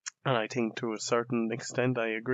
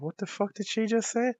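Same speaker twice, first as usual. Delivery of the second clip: high, pitched-up mock shock; stunned disbelief; 10 semitones higher than usual. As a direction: replay the jaw-drop, pitched up. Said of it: "I was like".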